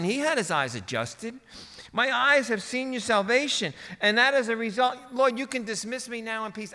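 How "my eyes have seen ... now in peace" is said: high-pitched, raised-voice elation; joyful proclamation, easing in volume at the end; ecstatic exclaiming. Acted. Preacher as Simeon praying to the Lord, ecstatic exclaiming. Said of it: "almost ecstatic and exclaiming".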